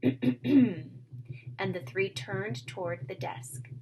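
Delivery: ordinary delivery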